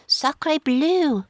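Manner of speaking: high-pitched, mild French shout; understated shout with a French accent; French-accented exclamation. A narrator's reading. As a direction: exclaim in mock French outrage. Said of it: "shouted Kennedy".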